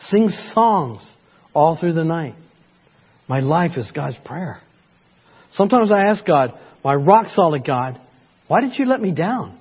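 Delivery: rising, pleading Bible reading; fervent psalm reading. Acted. Dramatic scripture reading.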